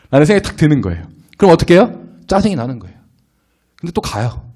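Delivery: ordinary talk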